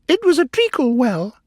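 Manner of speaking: very high, loud little creature; Dormouse voice. A narrator's reading. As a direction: squeak it out as a dormouse. Dormouse voice answering.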